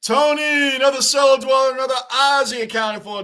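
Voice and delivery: loud, high welcoming call; hearty shout. Real, not acted. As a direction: call out a big greeting to a regular. Greeting an arriving viewer loudly.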